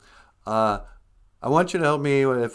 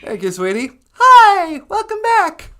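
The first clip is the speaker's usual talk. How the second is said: grandma voice; 10 dB louder than usual. Acted. High grandmother voice greeting.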